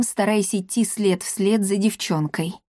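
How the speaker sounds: ordinary delivery, nothing marked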